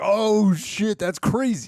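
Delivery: high, loud, slow mock shock; acted amazement. Co-host acting out reaction to last week's cliffhanger, amazed.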